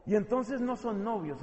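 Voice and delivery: usual speaking voice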